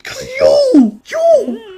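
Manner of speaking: very high, loud muffled sputter; Dad with a mouth full of muffin; Muppet-mouthed Pop. Retold or imitated. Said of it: "Your Pop's like".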